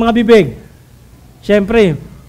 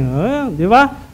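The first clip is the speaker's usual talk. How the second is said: usual speaking voice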